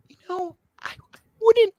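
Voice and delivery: tiny high, hesitant squeak; imitating a sheepish, squeaky plea; retold awkward request. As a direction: falter high-pitched before asking a favour.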